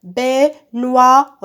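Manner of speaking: Baignoire in three loud, separated beats; syllable by syllable. Shown as a demonstration. Teacher clapping out syllables for pupils, syllable by syllable. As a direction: clap out Baignoire as BAI, GNOI, RE with gaps. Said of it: "Je frappe les syllabes".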